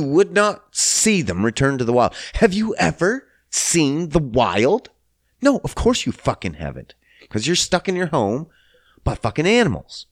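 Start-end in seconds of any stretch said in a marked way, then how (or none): none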